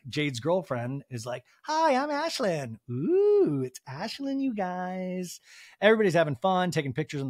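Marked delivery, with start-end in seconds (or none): 1.6-5.4 high, slow, drawn-out impression; mock high-pitched self-introduction; Ashlyn. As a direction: stretch it out in a high put-on voice. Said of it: "And Ashlyn, ... is like".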